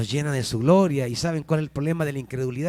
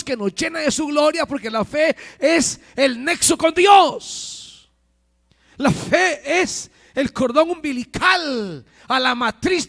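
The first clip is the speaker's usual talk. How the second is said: predicación apasionada; 12 semitones higher, 5 dB louder than usual. Real, not acted. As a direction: preach it high and insistent, stretching the key words. Preaching at full force. (predicando con fervor, en tono agudo)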